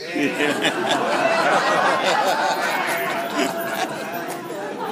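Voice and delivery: long loud laugh; laughing at his own bagpipe joke. Real, not acted. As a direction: let the laugh roll out. Laughs after pipes joke.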